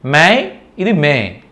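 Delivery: మే drawn out slowly like the month May; slow, careful teacher demo of మే; model pronunciation. Shown as a demonstration. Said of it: "అలా మే అని పలకాలి".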